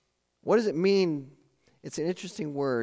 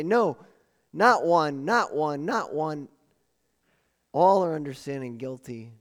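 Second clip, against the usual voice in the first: louder NOT on each not one; emphatic repetition; 4 dB louder than usual. Acted. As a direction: drive it home, line by line. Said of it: "He couldn't say it any more emphatically".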